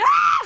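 very high, loud shriek; imitated shriek of shock; startled scream. Comedian imitating a very old spectator's fright, shrieked. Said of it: "Il m'a fait".

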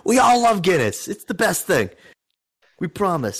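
light put-on chorus of loyal locals; committed Guinness fans. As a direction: pitch it up, overselling the praise. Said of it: "they have to commit to the bit".

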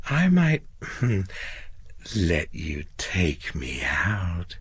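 coy pause, then a slow whispered offer; seductive, hushed invitation; flirty whisper. A narrator's reading. Receptionist flirting to placate him.

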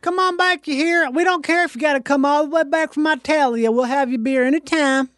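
high-pitched Southern twang; Southern saloon keeper. Acted. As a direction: call customers back with a high country twang. Joins the saloon act.

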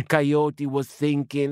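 usual speaking voice

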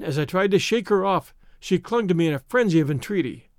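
ordinary delivery

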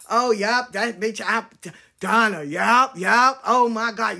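loud, excited gossiping voice; mock gossip. Mocking the gossips who talked behind her back, loud and catty.

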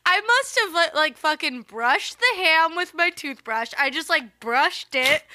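high, loud, laughing through the words; can barely hold back laughter. Real, not acted. Laughing through the ham story.

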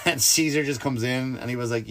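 normal talking voice

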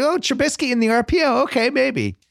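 high, doubtful mock hope; skeptical fan voice. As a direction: sound unsure and hopeful. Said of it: "you go".

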